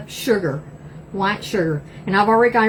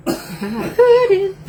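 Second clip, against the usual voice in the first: laughing pudding; 8 semitones higher, 3 dB louder than usual. Acted. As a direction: giggle out the word "Pudding". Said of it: "I'm preparing my pudding".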